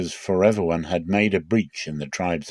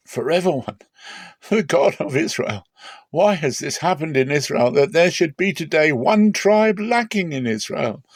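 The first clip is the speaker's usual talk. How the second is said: lamenting cry; 7 semitones higher than usual. A narrator's reading. The weeping people of Israel crying out to God, a rising lament. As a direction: cry out to God in grief, voice climbing. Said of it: "lifted up their voices, and wept severely".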